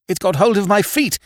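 high panicked cry; scared scream. A narrator's reading. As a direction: cry out as it grabs you. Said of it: "he screamed".

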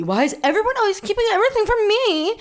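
fast, high, loud complaint; all worked up. Retold or imitated. Girl suspects huge conspiracy against her, worked up. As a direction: rush it out, indignant and dramatic. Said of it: "getting all worked up".

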